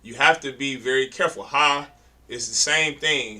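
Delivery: ordinary talk, nothing marked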